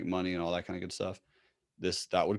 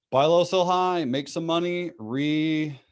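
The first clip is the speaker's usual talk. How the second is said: higher, louder hype; excited; 8 semitones higher, 5 dB louder than usual. Real, not acted. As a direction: pump it up like a slogan. Repeating trading motto with gusto.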